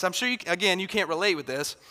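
ordinary delivery

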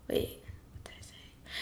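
soft unvoiced whisper to self; whispered aside. Real, not acted. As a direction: whisper it as if to yourself. Forgot own words, aside.

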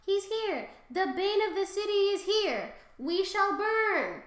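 high, loud prophetic cry; shouted warning. A narrator's reading. Cassandra shouting that the city's bane has arrived, alarmed cry. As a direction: cry out the doom, stretching the last phrase. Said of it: "began to shout out".

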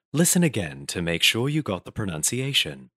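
instructional read in an English accent. Shown as a demonstration. Voice actor instructing students learning English, English accent lesson read. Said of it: "they want me to do an English accent".

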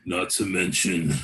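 deep, slow Undertaker promo voice; Undertaker wrestling promo. Pretend. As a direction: growl it low like the Undertaker. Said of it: "picture fucking Undertaker talking, like, doing a promo".

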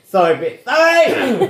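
high, loud, laughing sorry; laughing shouted apology. Retold or imitated. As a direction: shout over the spin cycle, laughing. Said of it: "It was like".

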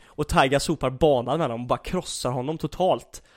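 ordinary delivery

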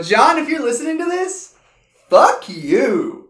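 mock insult. Mock insult to absent friend.

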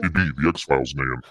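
voice changer. Pretend. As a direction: speak through a voice changer. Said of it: "that voice changer".

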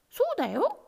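high, pleased kitten chorus. A narrator's reading. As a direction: answer brightly, together. Kittens answering.

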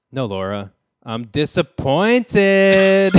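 drawn-out high squeal on disappointed; comic overdone dad; funny disappointed dad. Pretend. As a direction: ham the last word up slowly and loud. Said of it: "like in a funny way though".

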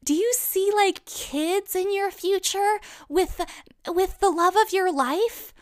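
high, slow, prying mock voice; pry sweetly into her love life; strange woman's question. Stranger asking Kaylee about kids, high and prying. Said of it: "the strange woman is like".

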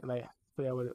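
ordinary delivery

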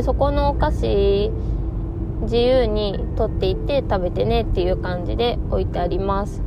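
ordinary delivery

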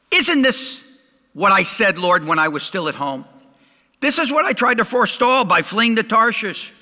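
high, loud indignant complaint; aggrieved prophet raising his voice; angry prayer. A narrator's reading. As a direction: read it as an angry protest to God. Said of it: "he became angry".

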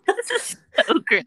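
high laugh-crying. Real, not acted. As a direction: squeal through laughter. Laughing hard.

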